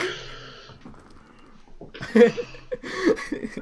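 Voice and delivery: very high, breathless laughter; high wheezing laugh. Real, not acted. Host laughing at his mistake, high wheezing laugh.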